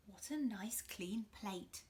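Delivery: slow whispered mum; slow whispered praise. Acted. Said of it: "she said".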